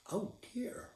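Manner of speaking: high, soft, sorrowful sigh; soft pitying old woman; sad old woman. A narrator's reading. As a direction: let her disappointment show gently. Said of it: "the old woman said sadly".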